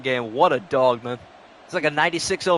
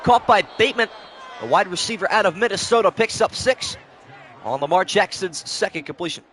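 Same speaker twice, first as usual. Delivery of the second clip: lively sportscaster calling the play; 5 semitones higher than usual. Acted. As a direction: call it like a TV broadcaster. Madden play-by-play call.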